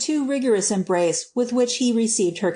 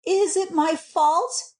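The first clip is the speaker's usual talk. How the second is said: high, slow, plaintive question; crying out; 8 semitones higher, slower than usual. A narrator's reading. Wife asks her husband about the lost baby, crying out. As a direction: ask it pleadingly, drawn out. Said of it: "she cried".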